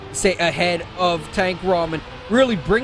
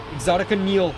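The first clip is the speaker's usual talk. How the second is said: ordinary talk, nothing marked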